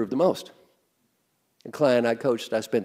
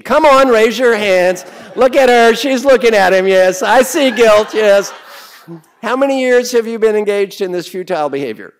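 calling out to the crowd; 7 semitones higher, 13 dB louder than usual. Real, not acted. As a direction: shout it out to the whole hall. Coach calling out to the audience.

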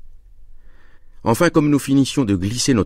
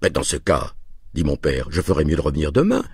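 usual speaking voice

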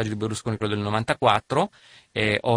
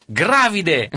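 loud, high eureka cry; triumphant shout; 8 semitones higher, 5 dB louder than usual. Retold or imitated. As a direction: announce the nickname triumphantly. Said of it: "spalanca la porta e dice". (esclamazione trionfante)

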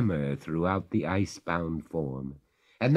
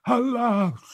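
high, louder lament; crying alas; 5 dB louder than usual. A narrator's reading. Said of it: "cried the ghost".

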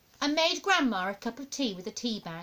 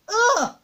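put-on high, loud boyish yuck; high child's noise of revulsion; disgusted groan; 12 semitones higher, 8 dB louder than usual. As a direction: recoil with a loud "ugh". Boy disgusted by caterpillars.

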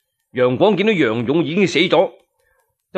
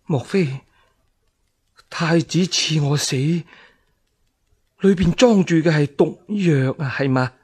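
frightened question. Acted. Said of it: "不禁心驚膽戰".

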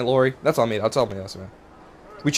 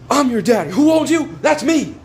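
trash talk shout; 7 semitones higher, slower than usual. Real, not acted. Trash talking the opponent in game.

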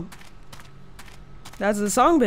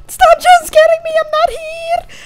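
1 panicked scream; 8 dB louder than usual. Real, not acted. Scared gamer screaming at jumpscare.

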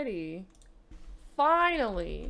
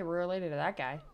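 ordinary talk, nothing marked

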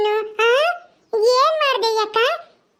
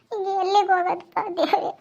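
quiet, weepy plea to God; tearful lament; 4 semitones lower, 4 dB quieter than usual. Acted. Abandoned wife asks God where to go, tearful lament. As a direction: lament helplessly through tears. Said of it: "ಯಾಕ್ ಹಿಂಗ್ ಅಳ್ತೈತಿ".

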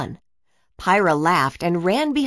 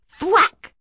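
kick sound effect; 11 semitones higher, 5 dB louder than usual. A narrator's reading. Ball kicked, sound effect.

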